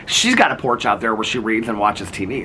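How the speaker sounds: ordinary delivery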